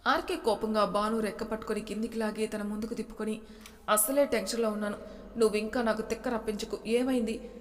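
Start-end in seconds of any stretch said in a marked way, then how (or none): none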